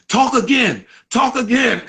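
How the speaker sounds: high-pitched mock disbelief, insisting on hearing it; astonished demand. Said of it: "Her eyes was wide open".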